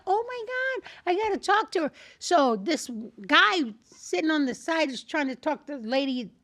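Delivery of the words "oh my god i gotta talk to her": high, hushed excitement; excited squeal kept down in volume. Retold or imitated. Spotting a good friend in class, high and excited.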